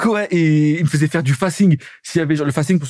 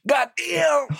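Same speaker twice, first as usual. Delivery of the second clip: high, shouted God damn; hammed-up English outburst; mock American exclamation; 11 semitones higher than usual. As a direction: bark it like a cartoon American.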